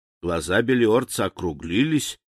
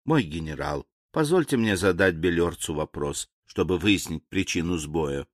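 deferential servant; 6 semitones lower, 5 dB quieter than usual. A narrator's reading. Calm servant asks master's permission.

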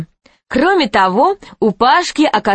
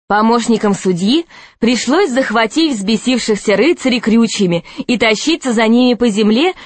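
ordinary delivery, nothing marked

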